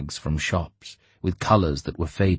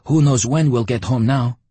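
higher and louder, bluff; loud declaring voice; 7 semitones higher, 5 dB louder than usual. A narrator's reading. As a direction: declare it like a hungry soldier. Lyocha voiced.

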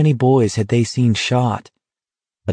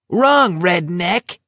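high jeering yell from afar; taunting yell; 9 semitones higher, much slower than usual. Acted. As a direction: shout a taunt into the dark. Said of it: "Barkovich yelled out of the darkness".

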